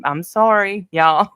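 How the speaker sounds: real rush of emotion breaking into a small laugh; getting emotional. Real, not acted. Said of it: "I'm gonna get emotional".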